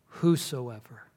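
whosoever drawn out slowly and deliberately; slow weighty whosoever; emphasized whosoever. Retold or imitated. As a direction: linger on whosoever as if it names you. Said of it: "When she said".

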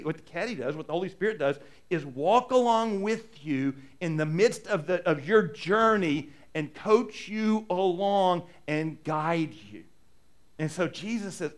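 usual speaking voice

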